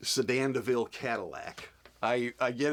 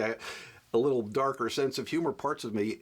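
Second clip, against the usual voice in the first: ordinary delivery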